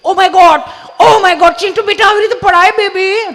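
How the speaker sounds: high, loud fussing mother; panicked modern mom. Acted. Modern mother fussing loudly over fallen Chintu. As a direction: gasp over the fallen baby. Panicked mum runs to child.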